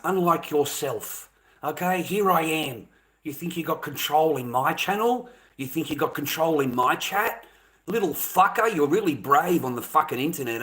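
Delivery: normal talking voice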